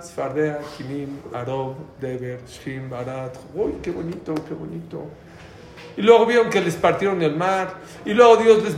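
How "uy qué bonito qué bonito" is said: soft, lazy admiring murmur; mock idle admiration. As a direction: coo like a bored spectator. Passive people watching the plagues.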